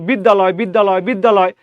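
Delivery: বিদ্যালয় blended quickly into one word; run together fast. Shown as a demonstration. Teacher to class, fast blended বিদ্যালয়. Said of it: "যখন আপনি দ্রুত উচ্চারণ করবেন".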